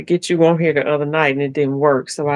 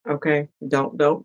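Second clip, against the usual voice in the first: usual speaking voice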